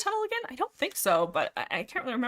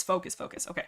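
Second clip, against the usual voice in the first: whispered self-talk; 7 dB quieter, faster than usual. Real, not acted. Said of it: "Okay, focus".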